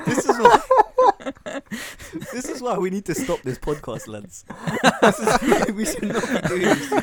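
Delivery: high, loud, words through laughter; laughing hard. Real, not acted. Co-host won't give an opinion, helpless loud laughter. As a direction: crack up while protesting. Cracking up at co-host's indifferent answer.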